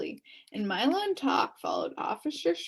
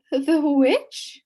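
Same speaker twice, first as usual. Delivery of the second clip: trembled; 6 semitones higher, 9 dB louder than usual. Acted. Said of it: "trembled Milo".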